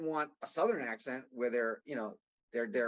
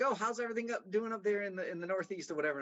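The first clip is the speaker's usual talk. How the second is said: higher-pitched mimicked client, settling by the end; imitated Southern caller; 6 semitones higher, faster than usual. Client asking the young rep about the Northeast, lightly imitated Southern caller. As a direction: lightly mimic a chatty Southern woman on a call. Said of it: "some Southern, you know, accent".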